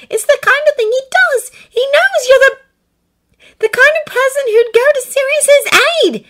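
high, loud, insistent urging; urgent high-pitched appeal; pleading. A narrator's reading. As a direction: argue desperately in a high voice. Acted pleading argument, girl character.

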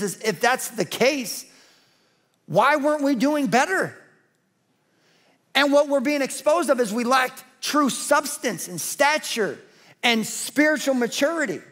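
usual speaking voice

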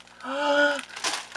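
big high gasp; sharp, loud gasp of surprise; astonished gasp. Real, not acted. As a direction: gasp loudly in amazement. Astonished on opening Beth's gift.

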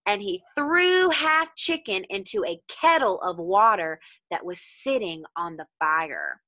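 ordinary delivery

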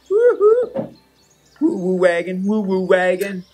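high, loud whoops; excited woo-hoo. Real, not acted. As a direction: cheer like you're chasing a train. Cheering over a leprechaun hunt.

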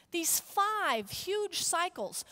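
normal talking voice

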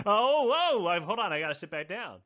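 ordinary talk

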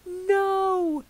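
high, loud "No"; group shout of refusal. Acted. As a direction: everyone shouts it at once. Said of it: "shouts everyone".

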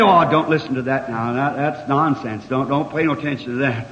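low, hushed aside as the scoffers; dismissive naysayers. Acted. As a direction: drop low and soft, waving it away. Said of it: "many of 'em say".

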